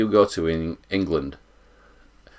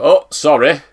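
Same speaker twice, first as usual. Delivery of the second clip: mock polite sorry, up and louder; a bright put-on apology to a queue jumper; passive aggressive sorry; 6 semitones higher, 8 dB louder than usual. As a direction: fake politeness with an edge. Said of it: "you go".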